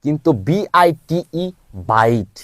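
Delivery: slow, clear contrast for a learner; teacher contrasting two words; slow contrast demo. Shown as a demonstration. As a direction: spell slowly, then set বিট against byte. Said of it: "বিট byte হয়ে যাচ্ছে".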